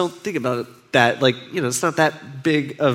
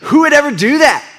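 indignant disciples; 5 semitones higher, 3 dB louder than usual. Retold or imitated. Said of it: "The disciples are like".

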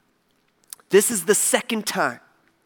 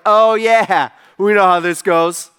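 louder, knowing, been-there-done-that; smug confidence; 7 dB louder, slower than usual. Acted. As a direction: toss it off like old news. Disciples blasé about a repeat miracle.

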